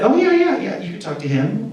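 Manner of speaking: high-pitched, breezy agreement; eager reassurance. Retold or imitated. Man offering people to vouch for him, eager reassurance. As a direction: imitate someone readily agreeing, pitch leaping up.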